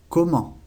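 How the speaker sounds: comment with a silent final T, nasal ending; French comment. Shown as a demonstration. Teacher models comment for students, final T silent. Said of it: "La última T no se pronuncia".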